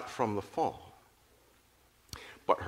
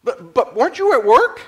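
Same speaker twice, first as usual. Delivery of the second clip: flabbergasted; 11 semitones higher, 9 dB louder than usual. A narrator's reading. Flabbergasted daughter questions father.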